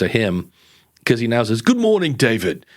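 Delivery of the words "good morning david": mock British greeting; British accent. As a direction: imitate a British voice assistant's greeting. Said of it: "he's in a British accent".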